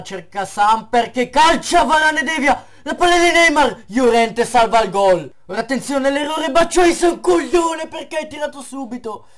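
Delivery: high, loud frantic play-by-play; shouted commentary. Real, not acted. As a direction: shout every save. Frantic goalmouth scramble commentary. (telecronaca urlata)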